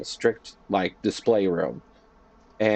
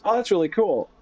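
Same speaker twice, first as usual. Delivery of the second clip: higher-pitched impressed reaction; 10 semitones higher than usual. Retold or imitated. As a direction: act out someone pleasantly impressed. Acting out impressed voice actor's reaction.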